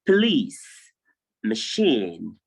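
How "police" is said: police, with a long ee sound; police said as puh-LEES. Shown as a demonstration. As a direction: stretch the ee in police. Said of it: "it is pronounced police".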